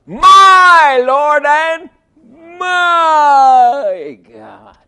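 high, loud, slow "My Lord and my God"; awed confession. Retold or imitated. Thomas seeing the evidence, crying out high and slow in awe. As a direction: proclaim it in awe, stretching each word. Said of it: "didn't say".

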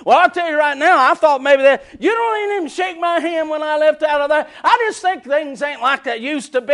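a loud grumbling member; lightly voiced grudge-holder; mock church grumbler. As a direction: whine about being slighted. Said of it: "get the attitude".

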